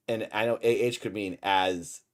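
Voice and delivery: ordinary talk, nothing marked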